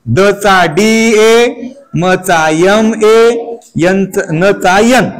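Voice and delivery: slow letter-by-letter dictation; slow spelling. Shown as a demonstration. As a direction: spell it out slowly for the class. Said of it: "तर कसं लिहिलं मी?".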